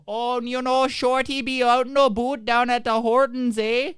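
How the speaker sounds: high, loud hammed-up Canadian accent; mock Canadian accent, pitched high. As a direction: lay the Canadian accent on thick with a big eh. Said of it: "that's the real Canadian accent".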